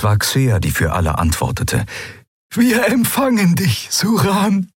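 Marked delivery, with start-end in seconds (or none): 2.5-4.7 much higher, joyful reply; Xea's voice. Acted. Xea happily answers old friend Suran. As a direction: answer him high and happy. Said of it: "sagte sie".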